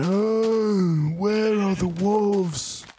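high, slow and wondering; high, drawn-out question. Acted. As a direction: stretch it out up high. Searching for wolves.

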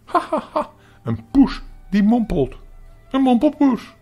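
high, loud hooting laughter; high-pitched cackling through the line; laughing mockery. A narrator's reading. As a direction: hoot with silly laughter at the words. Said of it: "Wat valt er nou zo mal te lachen".

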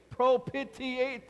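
slow, careful propitiate, syllable by syllable; drawn-out "Propitiate". Shown as a demonstration. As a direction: sound out "Propitiate" slowly and clearly for the congregation to repeat. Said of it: "Say that with me".

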